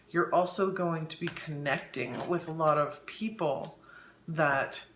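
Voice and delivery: ordinary talk, nothing marked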